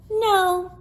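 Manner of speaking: put-on high child's no; a little kid denying it. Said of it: "said Helen".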